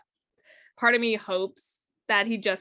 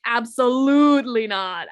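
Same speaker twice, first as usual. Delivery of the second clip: slow, louder ABSOLUTELY; emphatic refusal; 6 dB louder, slower than usual. Real, not acted. Co-host rejecting another star as Frank-N-Furter, slow and emphatic. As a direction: draw it out forcefully, shutting the idea down. Vehemently rejects Jagger in the role.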